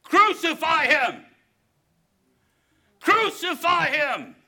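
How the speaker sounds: same crowd turned against Jesus, yelling in rage; angry mob yell. Acted. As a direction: roar it like a mob demanding death. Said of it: "would a week later be shouting".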